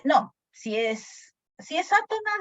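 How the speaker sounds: ordinary delivery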